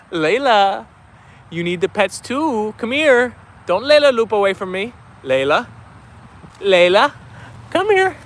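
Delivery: coaxing pet-talk, rising on the last call; calling the dog. Real, not acted. Calling her dog over.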